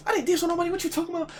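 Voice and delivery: mock high-pitched denial; high squeaky protest. Defending himself, retold.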